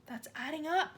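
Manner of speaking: put-on a scared boy pleading; frightened cry. A narrator's reading. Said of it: "Wilfred cried".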